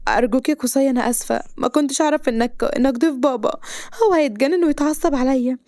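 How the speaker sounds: high-pitched, apologetic begging; anxious pleading. A narrator's reading. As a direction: apologise desperately, pleading for mercy. (بتوسل واعتذار)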